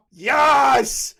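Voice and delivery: high, loud yes; triumphant shout. Real, not acted. Streamer cheering at the reveal with a shout. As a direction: cheer like you just won.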